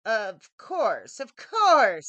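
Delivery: put-on loud, rising fawning old woman; a greedy hag sensing profit; eager hag. A narrator's reading. Hag voiced in story.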